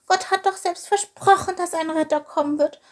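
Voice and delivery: high, tearful little voice; sobbing protest. A narrator's reading. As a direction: plead through mock sobs, pitched high. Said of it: "schluchzte er".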